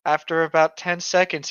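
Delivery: ordinary delivery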